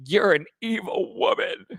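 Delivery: high, hammed-up accusing voice; mock accusation. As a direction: camp it up like a wounded diva. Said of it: "Denise is like".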